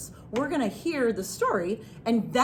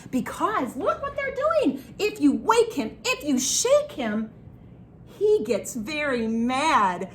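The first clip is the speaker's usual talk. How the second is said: higher, building playful warning; animated storytelling; 3 semitones higher, slower than usual. Pretend. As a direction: stretch out the warning for young children. Animated telling of waking the bear.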